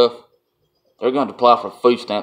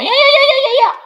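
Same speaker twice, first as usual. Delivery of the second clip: very high, louder mock squeal; squeaky mini pig; 6 dB louder than usual. Mocking the angry scammer's voice, very high squeaky yeahs. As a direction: imitate an angry caller gone pig-squeaky. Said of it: "talking like some little mini pig".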